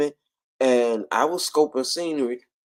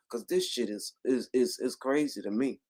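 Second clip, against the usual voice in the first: ordinary talk, nothing marked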